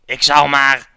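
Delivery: big, booming call-out; loud hyped shout. Acted. Player launching into the game, shouting. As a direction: belt it out at full volume.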